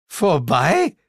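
high, rising question; loud disbelieving query; incredulous echo. A narrator's reading. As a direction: echo the word in dismay. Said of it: "echote ich".

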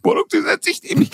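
high, fast, breaking into a giggle; squeaky panic. Retold or imitated. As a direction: squeal it like you can't believe your luck. Inner panic peaks, squeaky voice.

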